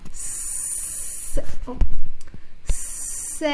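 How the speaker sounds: S-, oh, Sam drawn out with the voice kept on; slow blended Sam; stretched blend. Shown as a demonstration. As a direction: hold each sound of Sam and glide them together. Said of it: "we're gonna keep our voice on".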